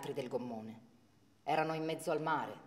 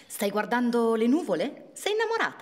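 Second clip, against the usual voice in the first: teasing; 7 semitones higher, 8 dB louder than usual. Acted. Parent teasing daydreaming daughter.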